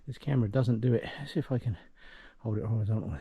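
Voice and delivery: usual speaking voice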